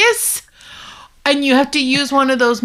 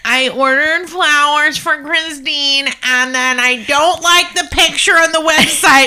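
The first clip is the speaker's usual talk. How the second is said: mock complaint; 4 semitones higher, 3 dB louder than usual. Teasing co-host's shout out turned complaint, mock complaining. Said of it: "turns her shout out into a complaint".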